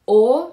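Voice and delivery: او as a loud back rounded "o"; plain O. Shown as a demonstration. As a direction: contrast take: او as pure "o", not fronted.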